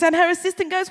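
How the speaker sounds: ordinary delivery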